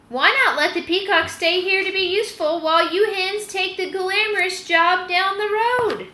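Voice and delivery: a hint of a scheming old dog; sly hound. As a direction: nudge them slyly toward the plan. Old hound voiced in story.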